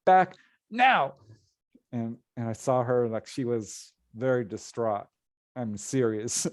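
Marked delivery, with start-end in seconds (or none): 0.1-1.2 retold scream. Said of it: "screaming at me".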